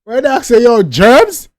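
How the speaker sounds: high, loud stunned yelps of disbelief; shocked disbelief. Real, not acted. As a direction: react like you can't believe what you just heard. Shocked at the song lyrics.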